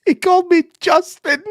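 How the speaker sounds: high, loud disbelief; incredulous reveal. Real, not acted. Revealing the neighbour's wrong name, incredulous and high. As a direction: squeal the punchline. Outraged punchline.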